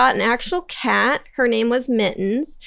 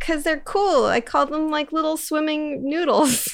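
put-on kid voice; 6 semitones higher than usual. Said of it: "And I was like".

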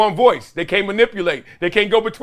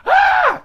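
high sharp mock scream of a hurt kid; imitated scream; 4 dB louder than usual. Dad imitating his son's hysterical scream, high yelp. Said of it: "Same scream".

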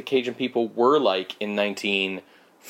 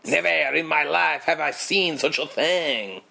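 hammed-up French peasant accent, pitched higher; mock French accent; 6 semitones higher than usual. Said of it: "that is literally how they sound".

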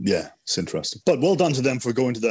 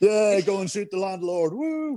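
slow, high, hammed-up cheer with a whooping high woo; mock cheering crowd; 6 semitones higher, slower than usual. Co-host mocks the villagers cheering him off, high slow cheer. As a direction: egg him on like a giddy onlooker.

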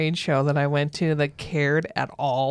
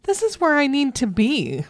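higher-pitched, emphatic thrill; retold delight; 6 semitones higher, 3 dB louder than usual. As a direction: relive the delighted discovery. Retold delight at exhibitor lounge.

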